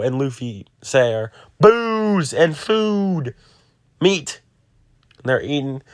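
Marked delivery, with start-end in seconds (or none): 1.6-4.4 drawn-out, high, pleading cry for food; starving demand for food. Retold or imitated. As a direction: stretch it out like a famished pirate.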